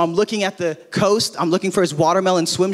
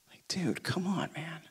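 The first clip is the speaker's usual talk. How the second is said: whispered fond exasperation; exasperated whisper; 14 dB quieter than usual. Retold or imitated. Father to runaway toddler, whispered exasperation. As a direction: breathe it out, shaking your head.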